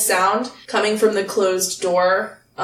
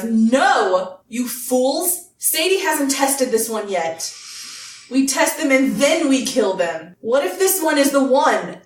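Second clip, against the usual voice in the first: higher-pitched, lightly acted boss rebuke; a touch of an exasperated villain leader; exasperated scolding; 5 semitones higher than usual. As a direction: scold your foolish underlings, mildly theatrical. Game master voices boss scolding underlings.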